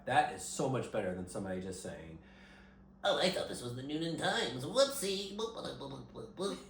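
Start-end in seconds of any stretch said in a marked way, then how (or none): 3.0-6.6 goofy clueless sitcom voice; silly high ditzy voice; Robin impression. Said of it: "That's my Robin from Stranger Things impression".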